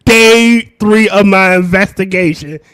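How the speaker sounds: loud, slow, portentous log entry; mock investigator's log. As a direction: narrate your case log with mock gravity.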